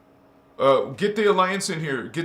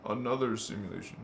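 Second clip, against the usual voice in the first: lower, softer, faster like an echo trailing off; fading echo; 8 semitones lower, 8 dB quieter, faster than usual. Shown as a demonstration. Explaining nested worlds, echoing the phrase fading away. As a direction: let it drift away like an echo.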